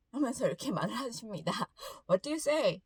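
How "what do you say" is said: scolding, a touch annoyed, stress on say; mother scolding her son after he bumped a stranger, mildly angry tone. Retold or imitated. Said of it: "이렇게 약간 화가 난".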